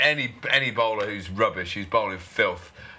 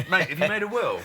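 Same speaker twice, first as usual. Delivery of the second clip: mock sledge; 7 semitones higher than usual. Inventing a sledge, laughing.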